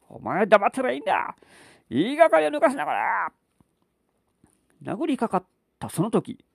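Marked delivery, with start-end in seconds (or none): 0.1-3.3 put-on higher, furious drunk husband; angry drunk father. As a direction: roar at her to shut up.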